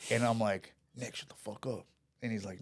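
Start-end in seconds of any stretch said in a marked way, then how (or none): 1.0-1.9 much softer, as a retold aside; retold hushed warning. Salesman to friend who is provoking an armed man, hushed retold aside. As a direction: quote yourself quietly shushing a friend.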